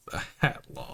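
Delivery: whispered under the breath, rasping; a hushed aside about the hat law; muttered. Acted. Cop mutters line again.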